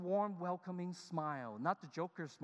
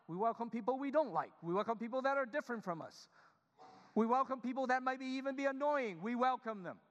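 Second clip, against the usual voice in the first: higher, louder insistent preaching; emphatic preaching; 6 semitones higher, 4 dB louder than usual. Real, not acted. Pastor urging the congregation to welcome everyone, emphatic. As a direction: hammer home the welcome.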